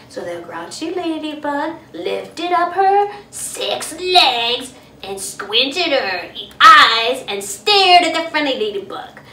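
ordinary delivery

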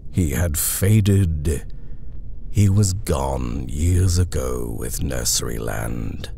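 measured, solemn reading of a poem over music. A narrator's reading. Narrator reading a haunting war poem to listeners, slow and grave. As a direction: let each line fall slowly and gravely.

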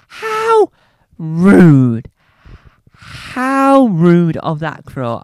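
hammed-up offended shriek; theatrical indignation, high and loud; mock outrage.

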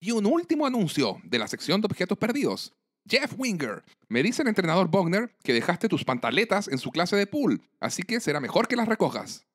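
high-pitched PA announcer; dean's high announcing voice; loudspeaker announcement. Acted. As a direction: announce it over the school loudspeaker. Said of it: "Dean Pelton por altoparlante dice".

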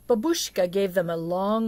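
usual speaking voice

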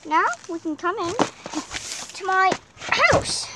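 ordinary talk

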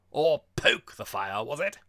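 slow, loud taunt climbing at the end; loud teasing. A narrator's reading. Girl mocks the would-be burglar catcher, loud and teasing. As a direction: needle him with a drawn-out question. Girl teases him about poker.

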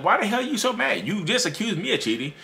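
high-pitched, incredulous protest; mock-indignant retort; indignant comeback. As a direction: act out baffled indignation, pitch leaping up. Acting out baffled reply to rage.

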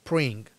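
"spring" with only "pring" sounded, the S left off; rest after the hiss. Shown as a demonstration. Teacher splitting the word for learners, "spring" without its S. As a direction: say just the "pring" part of "spring". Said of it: "y luego".

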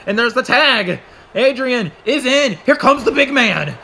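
rousing commentator shout; excited play-by-play. Real, not acted. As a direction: build excitement as the partner charges in. Calling the hot tag.

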